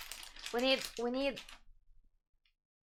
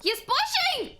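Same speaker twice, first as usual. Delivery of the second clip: excited; 4 dB louder than usual. Real, not acted. Teammate pushing in a fight.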